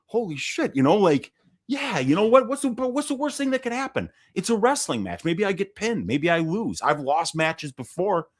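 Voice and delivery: fired-up inner voice. Retold or imitated. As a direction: talk yourself into the fight, getting excited. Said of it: "saying to myself".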